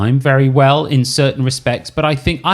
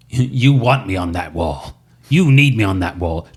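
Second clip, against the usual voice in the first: lightly voiced Nicholson; Jack Nicholson impression; 5 semitones lower than usual. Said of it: "he goes".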